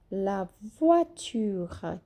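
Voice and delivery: "La voiture" slow, "tu" said like "tchu" with the R sounded and the final A faint; teacher drills "La voiture" slowly; slow French model. Shown as a demonstration. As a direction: spell out "La voiture" slowly for learners, the R clear and the end light. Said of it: "o tchu é como se fosse tchutchuca".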